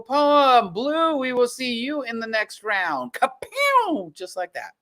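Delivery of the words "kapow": hype exclamation. Real, not acted. Host's sound effect flourish.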